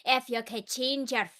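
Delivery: slow Scottish brogue, like Brave; lilting Scottish quote from a favourite film; Scottish accent. Retold or imitated. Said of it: "probably a Scottish accent".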